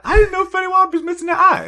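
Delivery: high, loud incredulous quote; mock astonishment. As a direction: imitate someone shocked by the news. Retells people's astonished reaction.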